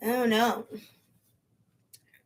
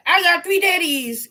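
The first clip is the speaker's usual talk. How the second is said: put-on kid voice; 7 semitones higher, 5 dB louder than usual. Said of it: "They kids be like".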